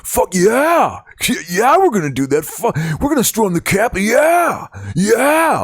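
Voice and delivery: dullard right-wing voice. Pretend. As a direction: play a rowdy, brainless right-winger, building to a shout on the last yeahs. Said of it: "here's my dullard right wing voice".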